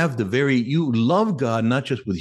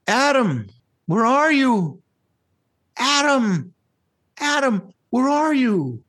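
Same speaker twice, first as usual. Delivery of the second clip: high, calling out, longing; plaintive cry of God; 13 semitones higher, 3 dB louder, slower than usual. Retold or imitated. God calling to hiding Adam, high plaintive cry. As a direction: call out for a lost son. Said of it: "this plaintive cry of God".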